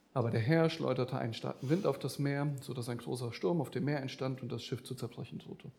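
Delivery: low, soft, hurried verse reading; casual rote reading. Shown as a demonstration. Preacher showing how we usually read the verse, casual rote reading. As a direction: read it quickly, with no weight on any word. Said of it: "wir lesen den, diesen Vers gerne wie folgt".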